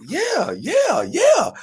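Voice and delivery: high, excited retold yeses; eager yes. Actor agrees to read another director's script, eager yes. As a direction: pitch it way up, overeager.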